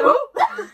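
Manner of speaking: shrieking burst of laughter; loud high laugh. Real, not acted. Friends laughing at the helium singing, loud shrieking laugh. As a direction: crack up loudly.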